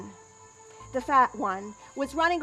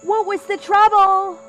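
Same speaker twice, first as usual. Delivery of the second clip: loud, high shout across a yard; raised voice, pitched high and projected; calling out; 8 semitones higher, 10 dB louder than usual. Acted. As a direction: call out loudly to someone at a distance. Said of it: "I called to him".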